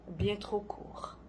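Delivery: slow, near-whispered afterthought; hushed regret. Acted. Poet mourns a moment too brief, hushed regret. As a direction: let the voice fall away almost to a whisper.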